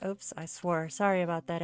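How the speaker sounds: usual speaking voice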